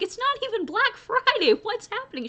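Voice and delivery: high squeaky voice of exaggerated disbelief, pitched far up; mock disbelief. Retelling her own reaction.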